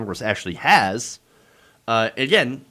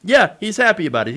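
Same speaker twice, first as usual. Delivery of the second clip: ordinary talk, nothing marked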